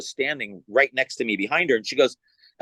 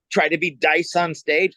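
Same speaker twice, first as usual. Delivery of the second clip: ordinary talk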